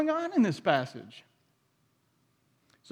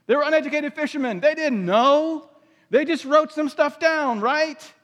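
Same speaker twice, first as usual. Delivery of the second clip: mocking dismissive; 6 semitones higher, 4 dB louder than usual. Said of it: "you always hear".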